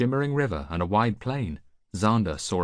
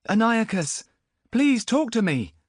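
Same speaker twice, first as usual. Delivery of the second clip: put-on much higher, louder, slow young voice; pleading boy; 6 dB louder than usual. Boy begs Antiochus, high pleading voice. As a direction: beg him to answer.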